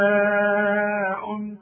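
سواء with the vowel held very long before the hamza; long madd. Shown as a demonstration. Quran teacher demonstrating the connected madd in سواء. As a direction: stretch سواء out long, slow. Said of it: "بعد عين".

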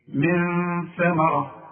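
من ثمرة drawn out slowly, nun hidden nasally before the tha; إخفاء بغنة; slow tajweed recitation. Shown as a demonstration. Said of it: "شوف".

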